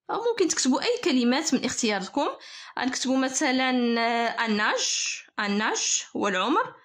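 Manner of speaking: ordinary talk, nothing marked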